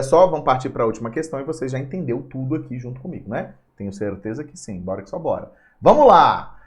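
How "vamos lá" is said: loud, high rally cry; rallying call. Real, not acted. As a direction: fire up the class, louder. Teacher rallying the class. (professor chamando a turma em voz alta)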